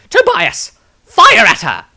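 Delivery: loud, high barked order; urgent command. Acted. Maestro ordering Tobias to shoot, loud and urgent. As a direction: call out the order sharply.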